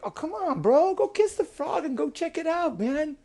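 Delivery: high-pitched cajoling frog; coaxing bullfrog. Acted. Bullfrog urging boy to kiss a frog, coaxing. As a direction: egg on a reluctant pal.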